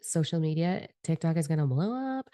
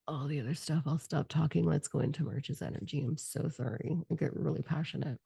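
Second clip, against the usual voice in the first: softer, backed-off aside after a rant; sheepish apology; 6 dB quieter than usual. Real, not acted. Apologising to viewers for getting too passionate, sheepish. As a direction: drop the volume and apologise for getting carried away.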